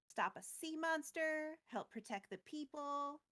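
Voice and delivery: ordinary talk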